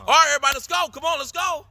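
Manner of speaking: high, loud eager teacher voice; pumped-up drama teacher calling everyone in; professor rallying class. Retold or imitated. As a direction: call the class to start like an excited professor.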